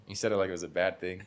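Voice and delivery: normal talking voice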